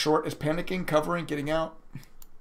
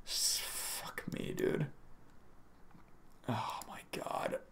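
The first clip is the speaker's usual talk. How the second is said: breathless whisper under his breath; stunned whisper; 3 semitones lower, 17 dB quieter, slower than usual. Real, not acted. Streamer whispering to himself over losing money, stunned whisper. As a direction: hush it out as if shocked by the loss. Reacting to a trading loss.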